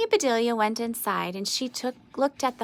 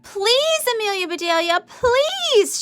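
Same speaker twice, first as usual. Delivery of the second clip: much higher, louder begging shout; shouted plea; 12 semitones higher, 5 dB louder than usual. A narrator's reading. Grizzlies shouting to Amelia Bedelia after two misses, high pleading shout. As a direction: plead at the top of the voice. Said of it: "shouted the Grizzlies".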